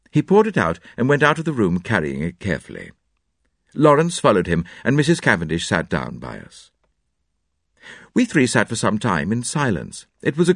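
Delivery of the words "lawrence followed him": ordinary delivery, nothing marked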